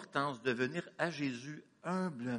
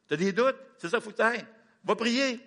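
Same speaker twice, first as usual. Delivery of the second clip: higher, louder command; urgent exhortation; 8 semitones higher, 6 dB louder than usual. Acted. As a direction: urge them to go and pray. Exhorting congregation.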